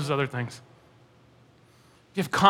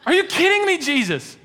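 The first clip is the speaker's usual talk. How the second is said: laughing disbelief; 10 semitones higher than usual. Pretend. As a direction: cry out in amused disbelief. Preacher reacting to the Lazarus story.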